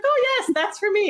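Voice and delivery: chirpy. Retold or imitated. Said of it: "And she's like".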